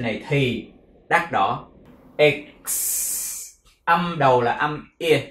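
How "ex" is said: "Ex-" broken down, ending in a long hiss; e then k-s. Shown as a demonstration. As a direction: sound out "Ex-" as e, k, then a held s. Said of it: "tiếp theo là âm cờ sờ". ("Ex-" phát âm rõ e, cờ, sờ kéo dài)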